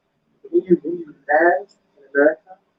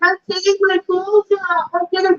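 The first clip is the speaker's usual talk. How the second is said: mock clueless woman; 11 semitones higher than usual. American woman answering guards.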